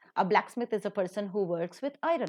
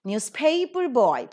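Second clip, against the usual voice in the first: put-on Newspaper boy with paper raised and drawn out; stress on PAPER; 3 semitones higher, 3 dB louder, slower than usual. Shown as a demonstration. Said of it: "paper is given the stress".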